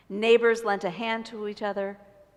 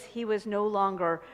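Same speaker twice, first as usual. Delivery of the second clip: ordinary delivery, nothing marked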